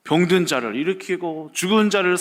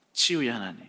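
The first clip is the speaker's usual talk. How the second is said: 조용한 되새김; 6 semitones lower, 6 dB quieter than usual. Shown as a demonstration. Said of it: "어떤 하나님인 거예요".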